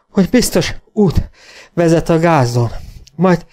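high, loud, out of breath; panting. A narrator's reading. Wounded rider reporting after a gallop, panting. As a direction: gasp out the report between breaths. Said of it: "lihegte a karvajórú Kóbor Jenny".

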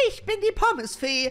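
lightly voiced fairy. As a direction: play the prim little fairy. Fairy character introduces herself.

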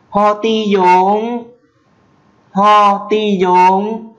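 "Ho ti dốn" cao, to và rất chậm; đọc chậm từng tiếng "Ho ti dốn", lên cao; Mường phrase, slow. Shown as a demonstration. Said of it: "tiếng Mường". (giọng Mường cao, rất chậm)

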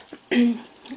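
clearing the throat after hiccups; quick throat clear. Real, not acted. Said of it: "Excuse me, I have hiccups".

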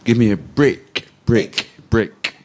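BRICK said slowly with a short, crisp "ih"; teacher's careful BRICK with a short "ih"; demonstrating short I. Shown as a demonstration. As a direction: model the short vowel in BRICK, deliberately. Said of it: "let us see the difference".

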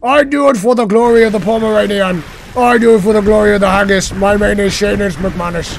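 loud, high Scottish battle declaration; Scottish accent. Pretend. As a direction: declaim grandly in a hammed-up Scottish brogue. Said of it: "Let me put on my Scottish accent".

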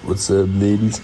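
loud put-on greeting voice. Shown as a demonstration. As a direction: belt it out in a fake voice. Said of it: "No, no, say in your real voice".

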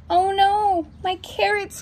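high-pitched rabbit wail, lightly acted; mock-distressed storybook cry; crying out in dismay. A narrator's reading. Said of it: "cried the rabbit".

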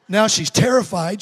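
ordinary delivery

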